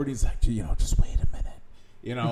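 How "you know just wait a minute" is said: quick imitated whisper. Hunting partner in the blind urging patience, whispered. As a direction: hiss it quickly like you're hiding from a deer. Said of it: "he's kinda whispering over".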